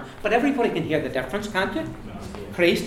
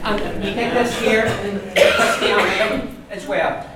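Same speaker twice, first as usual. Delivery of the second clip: normal talking voice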